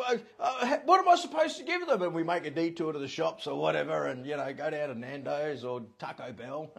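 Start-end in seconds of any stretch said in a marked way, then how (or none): 0.1-2.0 flustered. Retold or imitated. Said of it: "And it's like".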